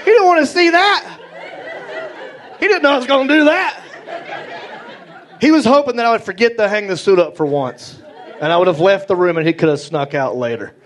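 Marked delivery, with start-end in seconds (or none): 0.0-6.7 excited. Real, not acted. Dad's horror retold, excited.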